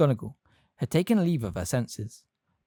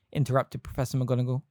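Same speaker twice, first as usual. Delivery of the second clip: ordinary delivery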